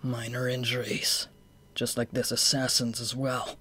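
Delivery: curt and drained; cold low voice. Acted. Injured, curt reply.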